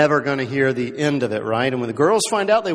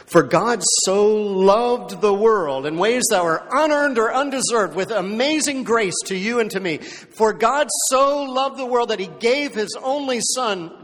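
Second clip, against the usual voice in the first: preaching; 6 semitones higher, 3 dB louder than usual. Acted. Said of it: "preaching the verse".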